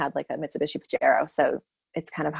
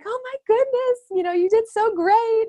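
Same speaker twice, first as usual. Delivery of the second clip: high, gushing praise; gushing doctor; 3 dB louder than usual. Retold or imitated. Doctor hugging the new mother gushes praise, high and bubbly. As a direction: gush over her like a proud fan. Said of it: "she's like".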